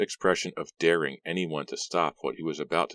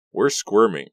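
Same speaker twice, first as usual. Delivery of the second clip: wry complaint; 5 semitones higher, 7 dB louder, slower than usual. A narrator's reading. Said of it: "Ricky said".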